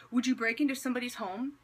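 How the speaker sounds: ordinary delivery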